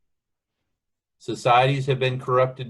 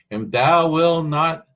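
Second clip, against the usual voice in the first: loud, slow, raised plea; crying out to God; 5 semitones higher, 6 dB louder than usual. Acted. Preacher voicing the prophet's prayer for deliverance, crying out. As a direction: press the plea upward, drawing out each word. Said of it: "So he's crying to God".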